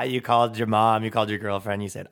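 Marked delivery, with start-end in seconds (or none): none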